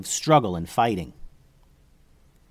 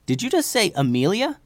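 put-on high, questioning kid voice; kid ninja; 8 semitones higher than usual. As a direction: ask like a puzzled kid, pitched up. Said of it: "asked the kid ninja".

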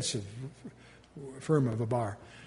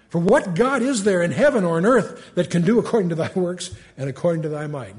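raised, louder King James reading; scripture reading; 4 semitones higher, 5 dB louder than usual. Acted. Preacher reading scripture to the class. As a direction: read the verses out with weight.

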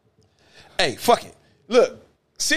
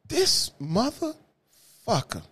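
slow dramatic buildup; 5 semitones higher, 4 dB quieter, much slower than usual. Acted. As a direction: stretch "This motherfucker" out slowly, drawn and heavy. Dramatic pause before the reveal.

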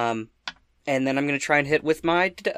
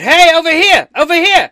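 very high, loud attention-grabbing yell; full-voiced distracting holler; shouting; 16 dB louder than usual. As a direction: shout to draw the monster's attention. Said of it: "what do you shout at this thing".

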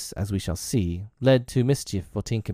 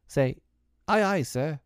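put-on higher-pitched boy giving an order; commanding boy captain; 8 semitones higher than usual. A narrator's reading. Peter tells John how to answer him, commanding boy captain. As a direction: model the crisp reply for a new recruit.

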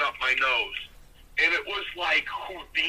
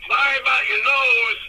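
high, loud mock apology; imitated loud, hearty jab; booming phone caller; 7 semitones higher, 6 dB louder than usual. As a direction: belt out a gloating apology, imitating a big wrestler. Acts out Doctor D's phone call.

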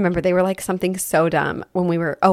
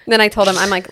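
ordinary talk

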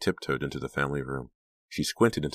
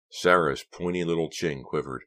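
ordinary delivery

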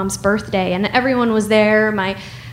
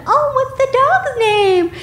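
high chirpy stranger. Retold or imitated. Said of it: "be like".

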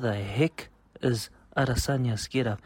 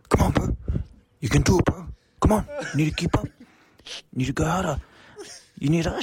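gym bro hype; 9 semitones higher, faster than usual. Retold or imitated. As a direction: pump up a gym partner mid-set. Gym bro shouting encouragement.

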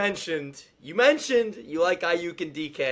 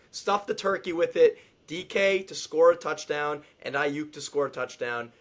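ordinary delivery

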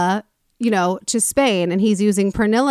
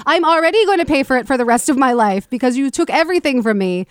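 retort; 5 semitones higher than usual. Retold or imitated. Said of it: "To which she retorts".

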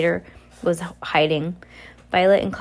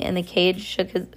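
ordinary delivery, nothing marked

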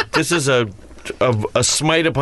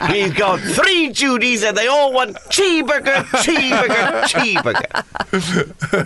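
high, hammed-up order call; shout-out of an order in a silly high voice, stretching the last word; counterman parody; 7 semitones higher than usual. Riffing as the cheeseburger counterman.

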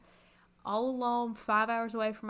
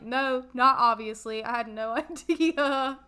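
laughing; 3 semitones higher, 9 dB louder, slower than usual. Retold or imitated. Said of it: "And I was like".

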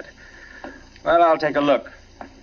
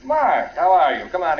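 calling out greeting; 6 semitones higher than usual. Acted. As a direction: hail the friend at the door. Calls visitor at the door.

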